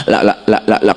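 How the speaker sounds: clipped, hurried "la, la" gabbled to the finish; rushed tahlil. Retold or imitated. Preacher mimics the rushed tahlil at a poor man's wake, hurried. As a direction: rattle through it to be done fast. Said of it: "tahlilan tiga puluh kelar".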